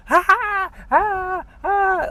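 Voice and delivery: very high mock maniac laugh; crazy street laugh. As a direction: cackle high like a madman on the street. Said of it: "crazy people just walking around on the street go".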